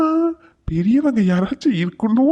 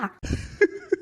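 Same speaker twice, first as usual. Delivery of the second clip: sudden high giggle, soft; short high-pitched giggle; high laugh; 11 semitones higher, 7 dB quieter than usual. Real, not acted. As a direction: crack up at the insult. Laughs at grandma's insult.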